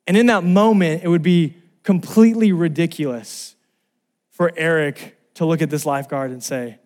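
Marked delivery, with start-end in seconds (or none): none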